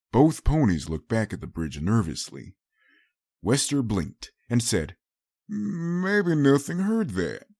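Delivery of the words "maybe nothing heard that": worried pony voice. Acted. Wester speaks after the explosion.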